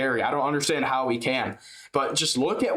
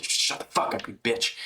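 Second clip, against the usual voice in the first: imitating Ian Gary; 4 semitones lower than usual. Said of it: "the tone of voice that Ian Gary was talking in".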